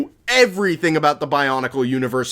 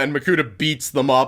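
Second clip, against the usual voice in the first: ordinary talk, nothing marked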